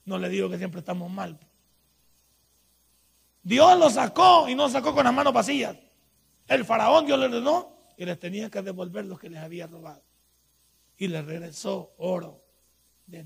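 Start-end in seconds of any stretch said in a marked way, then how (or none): 3.4-7.7 loud, fervent proclamation; preaching at full force. Acted. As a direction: declare it boldly to the church.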